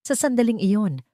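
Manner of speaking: plain reading voice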